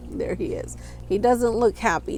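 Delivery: ordinary delivery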